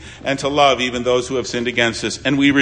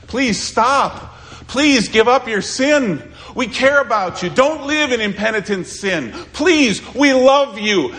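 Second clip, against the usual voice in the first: high-pitched, insistent plea; urgent pleading; 10 semitones higher than usual. Acted. Christians begging a neighbour to give up sin, urgent pleading.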